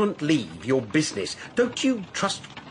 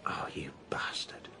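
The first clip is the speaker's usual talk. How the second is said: angry insult, breathed out in a whisper; bitter whisper; 15 dB quieter than usual. Acted. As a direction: spit the insult in a whisper, hurt and betrayed. Brother realizes he's been deceived.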